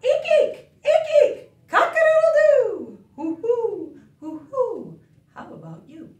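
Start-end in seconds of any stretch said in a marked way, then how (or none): 0.0-1.5 very high squeak with a giggle; squeaky shoe. Pretend. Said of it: "like a squeaky shoe". 1.7-3.0 very high, loud rooster call; rooster crow. Pretend. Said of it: "like a rooster". 3.2-5.0 owl hoot. Pretend. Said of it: "like an owl".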